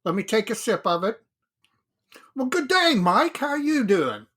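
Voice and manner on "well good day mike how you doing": bad Australian accent; hammed-up Aussie greeting. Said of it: "I won't do an Australian accent".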